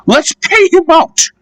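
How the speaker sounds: the coaches plotting to pay him back; spiteful coaches. Acted. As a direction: egg each other on to revenge. Coaches plot revenge.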